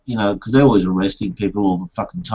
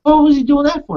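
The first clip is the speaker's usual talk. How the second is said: amazed; 6 dB louder than usual. Retold or imitated. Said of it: "I said".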